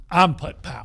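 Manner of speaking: booming intro. Retold or imitated. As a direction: boom the name out like a big man. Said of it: "stood up and said".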